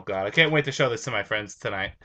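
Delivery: ordinary delivery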